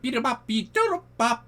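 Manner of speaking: high beeping alien gibberish. Retold or imitated. Cowboy imitating the Martian's reply, high alien beeps. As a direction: beep it like a little robot martian. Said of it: "he looked at me and he went".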